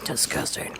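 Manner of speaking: whispered disgust; whispering. Real, not acted. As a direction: breathe it out in disgust. Reacting to sour candy.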